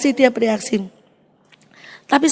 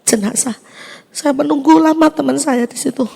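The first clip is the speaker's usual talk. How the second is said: ordinary talk, nothing marked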